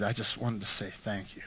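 low, hushed thanks; touch of tearfulness. Retold or imitated. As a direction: imitate a man quietly moved to thanks. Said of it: "And he starts crying".

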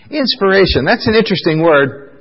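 ordinary talk

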